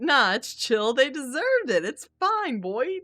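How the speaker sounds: mock-cheerful no-big-deal voice; breezy dismissal. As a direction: wave it away like it's nothing. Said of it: "Al is like".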